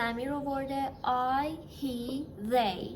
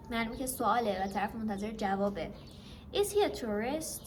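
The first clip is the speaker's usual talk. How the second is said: normal talking voice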